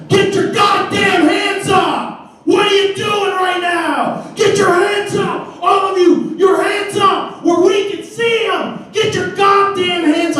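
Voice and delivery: high, slow, bellowed orders at gunpoint; imitating a cop shouting. Cop yells at the boys in the van, high mock bellow. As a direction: bark it like a cop drawing his gun. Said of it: "points it directly at the driver and says".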